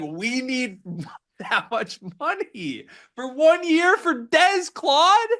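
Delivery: high, slow mocking impression of an agent; mock a greedy agent. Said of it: "be like".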